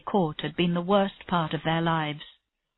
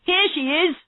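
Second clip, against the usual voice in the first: high, excited call; 10 semitones higher than usual. A narrator's reading. Someone calls to the team as Tennyson arrives, excited. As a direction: announce her arrival, pitched right up. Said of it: "Someone called".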